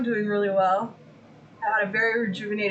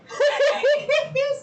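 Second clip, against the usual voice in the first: laugh; 3 dB louder than usual. Real, not acted.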